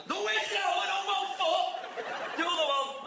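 high, slow scolding; nagging mum. Retold or imitated. Mum at Blackpool beach nagging the kid, high and slow.

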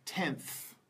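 "tenth" said alone with an audible final th; final th demo. Shown as a demonstration. Teacher models next word for learners, "tenth" with final th. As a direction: model "tenth", letting the last th hiss out. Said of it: "see it at the end of the word".

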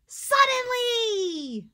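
slow, loud and high exclaimed word; excited. Shown as a demonstration. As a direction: stretch it out with excitement for the kids. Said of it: "you know our word".

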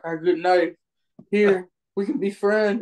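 high-pitched mock plea to make friends; pleading character. Acting out a character bargaining.

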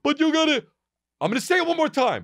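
high-pitched angry outburst, mocking; lashing out. Imitating guys lashing out at fans, high angry outburst. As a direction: act out losing your temper, voice jumping high. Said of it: "didn't lash out. So many guys would be like".

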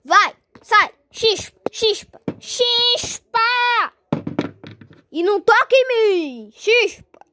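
loud repeated shooing. Acted. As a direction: drive her out loudly.

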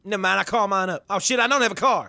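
a put-on Kanye voice, brash and cocky; [name] impression. Trash-talking strangers in game chat, brash Kanye voice. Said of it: "You guys wanna hear my real voice?".

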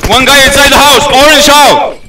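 high, loud callout; urgent shout. Real, not acted. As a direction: yell the enemy position. Urgent callout of enemy position.

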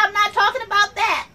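ordinary talk

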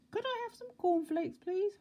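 tiny, very high squeaky voice; high-pitched mock whisper; timid whisperer. A narrator's reading. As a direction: squeak it like a timid little man. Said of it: "whispered Mister Quiet".

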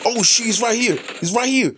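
loud, high alarmed yell; panicked shout. Real, not acted. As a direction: shout the warning as the enemy pops up. Enemy suddenly appears in game.

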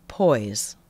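one low, deliberate word; poised single word. Shown as a demonstration. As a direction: drop it low and steady to center yourself. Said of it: "I just say the word".

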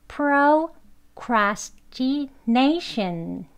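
procrastination syllable by syllable, drawn out; very slow. Shown as a demonstration. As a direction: sound out procrastination slowly for learners to copy. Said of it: "천천히 한번 해볼게요". (procrastination을 한 음절씩 아주 천천히)

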